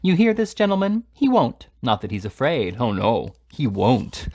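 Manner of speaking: sarcastic jeering; mocking. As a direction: play to the crowd with scorn. Slurk mocking Pott to others.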